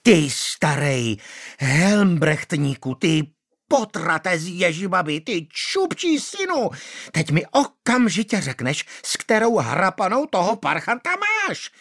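high screeching fury. A narrator's reading. Manda screeching at the magistrate. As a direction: shriek it at him. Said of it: "ječela Manda".

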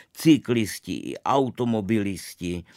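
usual speaking voice

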